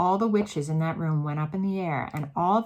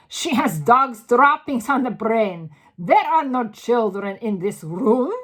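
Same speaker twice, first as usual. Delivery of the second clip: loud, higher dismissive scolding; scornful; 4 semitones higher, 10 dB louder than usual. A narrator's reading. Grand High Witch dismissing Mildred, loud scorn. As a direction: wave the claim away loudly.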